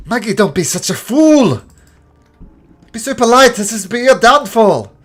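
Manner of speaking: high, loud furious scolding; angry shout. Acted. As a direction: yell at her for being a fool. Gasaliad scolds Maggie.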